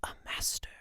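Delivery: smug whispered aside. Real, not acted. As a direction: whisper it proudly to yourself. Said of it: "self-praise aside".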